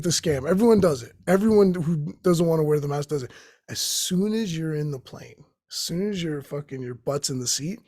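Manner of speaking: ordinary delivery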